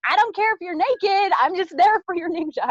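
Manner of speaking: high, loud, teasing insistence; hammed-up eager demand; playful insistence. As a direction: brush off her excuse with a grin. Retold joking demand at friend's door.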